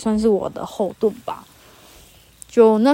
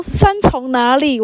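imitating a smug uncle, slow, higher and louder; smug questioning; 5 semitones higher, 8 dB louder, slower than usual. A chatty uncle triumphantly pressing the camp worker, slow smug question. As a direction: press the question like you've caught her out, drawn out. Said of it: "他很得意耶".

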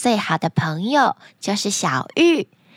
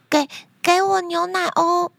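put-on higher, nervous child call with a stumbled start; somewhat louder call; 6 semitones higher than usual. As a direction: call a bit louder, still timid. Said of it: "这次小慧喊得比较大声".

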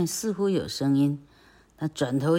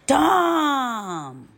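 very high, loud drawn-out cry; long, high, loud call; 11 semitones higher, 6 dB louder than usual. Acted. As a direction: yell his name across the house. Aunt crying out for Tom.

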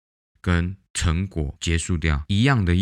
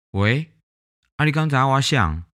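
a higher, louder mock voice of the caller, not her own; mimicking a rude caller; 7 semitones higher, 4 dB louder than usual. Imitating the caller who phoned her uncle during dinner, higher and louder. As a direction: play the pushy stranger who won't say who he is.

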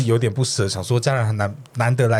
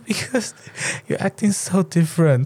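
lightly voiced date, higher and slow; mock quote of the date; 6 semitones higher, slower than usual. Retelling the date's words to her co-host, lightly voiced, slow. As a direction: play him saying it slowly, a touch put-on.

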